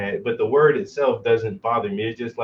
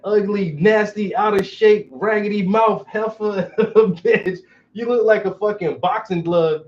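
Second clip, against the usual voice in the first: retold roast; 7 semitones higher than usual. Roasting the neighbor wife who called him a slur. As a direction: tear into her, amused and cutting. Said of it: "And I'm like".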